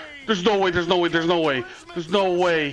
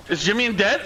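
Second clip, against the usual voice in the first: normal talking voice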